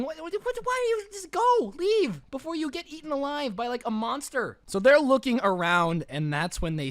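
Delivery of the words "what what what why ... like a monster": much higher, rising disbelief; high squeaky what-are-you-doing protest; incredulous outburst. Real, not acted. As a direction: yell at them like they're being stupid. Speaker yells at story's person.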